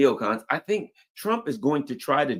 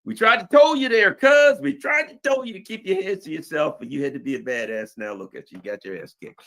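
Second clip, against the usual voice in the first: scolding friends; 8 semitones higher, 5 dB louder than usual. Retold or imitated. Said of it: "everybody's like".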